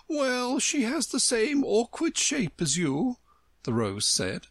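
high storybook flower, appraising; haughty talking Rose. A narrator's reading. The Rose describing someone to Alice, haughty. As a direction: pitch it high for a blunt flower.

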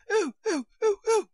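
high squealed ohs, climbing and loud; steam-whistle screams. A narrator's reading. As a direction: shriek like a steam engine's whistle. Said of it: "Her screams were so exactly like the whistle".

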